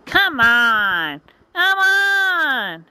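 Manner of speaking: rising to a very high taunt; taunting witch. Acted. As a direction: goad him to fight, witch voice.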